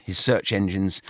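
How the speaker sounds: ordinary delivery, nothing marked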